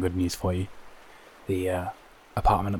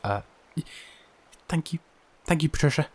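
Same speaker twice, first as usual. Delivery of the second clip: nervous stammer; 8 semitones higher, faster than usual. Acted.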